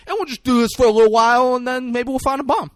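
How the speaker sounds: high, fast, loud silly instructions; goofy instructing voice. Retold or imitated. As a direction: rattle it off like a dopey cop. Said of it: "He's like".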